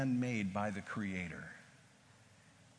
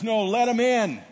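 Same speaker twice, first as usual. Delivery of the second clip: loud, high call to let them in; urgent shout; 11 semitones higher, 13 dB louder than usual. Retold or imitated. Exhausted Kevin shouting to let the latecomers in, loud and high. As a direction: call out over the crowd.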